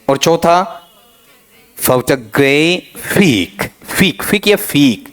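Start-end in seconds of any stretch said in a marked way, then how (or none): 1.8-5.1 very slow, drawn out photographic; slow stressed demo. Shown as a demonstration. Teacher models stress for students, very slow. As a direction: stretch each syllable out slowly to teach the stress. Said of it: "इस तरीके से".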